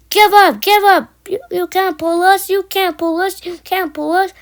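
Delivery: high, jeering chant from the trucks; calling out. A narrator's reading. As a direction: call out loud, then keep nagging high. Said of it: "called the cu-, trucks".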